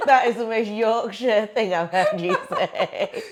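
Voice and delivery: helpless giggly delivery; amused exclamation. Retold or imitated. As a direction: tease fondly while laughing. Amused at guest's Yorkshire phrase.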